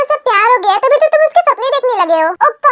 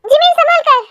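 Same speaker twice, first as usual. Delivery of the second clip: warning shout; 6 semitones higher than usual. Acted. Seeing Jimin about to fall, a warning shout. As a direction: shout to stop her falling.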